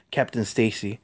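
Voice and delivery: plain reading voice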